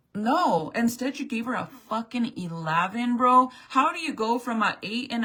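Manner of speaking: loud, furious outburst; angry rant. Real, not acted. Mother venting to viewers about the school, furious and loud. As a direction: let it rip, furious and loud. Mom ranting at the school staff.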